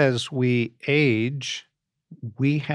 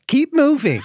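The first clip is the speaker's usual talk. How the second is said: high, louder call of a nagging watch; watch alert voice; 11 semitones higher, 4 dB louder than usual. Retold or imitated. Smartwatch prodding an old pope to get up, high mock alert. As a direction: pipe up like a little gadget. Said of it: "the little thing says".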